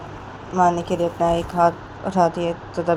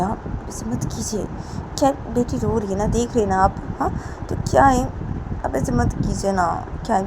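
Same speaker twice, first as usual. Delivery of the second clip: higher, softened appeal; anxious, coaxing plea; pleading Payal; 4 semitones higher than usual. Acted. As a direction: gently talk her down, imploring. Plays Payal pleading with Poonam.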